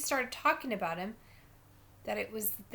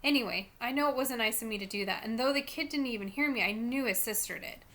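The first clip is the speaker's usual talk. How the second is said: ordinary delivery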